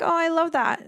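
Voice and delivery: high excited I love that; gushing clerk. Retold or imitated. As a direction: gush over it like a friendly cashier. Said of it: "She's like".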